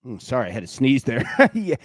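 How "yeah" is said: laughing. Real, not acted. Laughing after an on-air sneeze.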